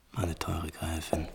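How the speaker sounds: hushed, slow whisper. Acted. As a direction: breathe the words, almost voiceless. Prinz liest den Brief leise. (langsam geflüstert)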